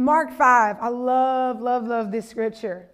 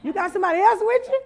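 muttered aside jumping to a high question; comic incredulous double take; mock bewilderment; 5 semitones higher, faster than usual. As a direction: play baffled, rising high at the end. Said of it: "I would have been looking like".

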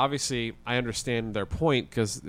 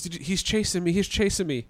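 imitating a panicked man, pitched much higher; freaked-out man; 8 semitones higher than usual. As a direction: play the shaken crash driver, voice up high. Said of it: "He's freaked out".

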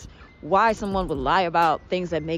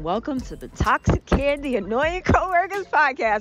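amused, smiling welcome; smile in voice; 5 semitones higher than usual. Real, not acted. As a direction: grin through the show title. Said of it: "have a smile in my voice".

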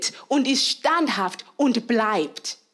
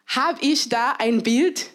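normal talking voice

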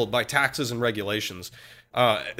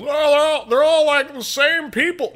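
mock naive voice; 12 semitones higher, 5 dB louder than usual. Mocking modern view of UK.

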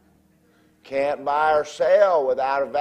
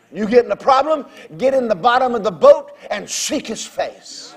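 full-force preaching; 7 semitones higher, 7 dB louder than usual. Real, not acted. To the congregation, loud preaching about God and hell. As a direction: drive the warning home to the congregation.

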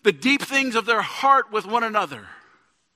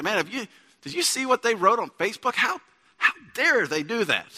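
mock indignation; 3 semitones higher than usual. Church member griping to a neighbor about a Facebook post, mock-indignant. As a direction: act out an offended gossip's indignation.

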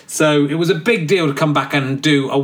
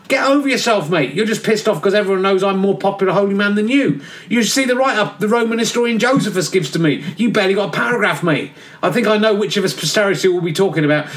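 cheeky geezer John; 5 semitones higher than usual. Acted. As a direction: play John as a careless lad in a cell. John the Baptist mocking.

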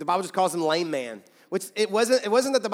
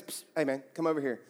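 low, hushed mock-secretive call; sly parrot beckoning; 5 semitones lower, 7 dB quieter, faster than usual. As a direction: beckon him over on the quiet.

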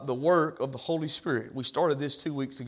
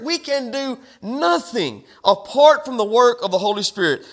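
raised, higher, forceful pulpit voice; impassioned preaching; 8 semitones higher, 8 dB louder than usual. Real, not acted. Pastor urges church to depend on the Holy Spirit, impassioned preaching. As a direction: build into full-force preaching, hammering each repeated phrase.

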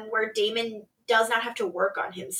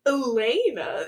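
"Elena" drawn out slowly; knowing, lingering stress on "Elena"; name in quotes. Retold or imitated. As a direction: stretch "Elena" as if in air quotes. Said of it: "in quotes".

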